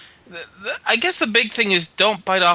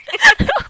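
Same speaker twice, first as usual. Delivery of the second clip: high laugh; 5 dB louder than usual. Real, not acted. Laugh after mock anger.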